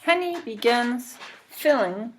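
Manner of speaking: ordinary delivery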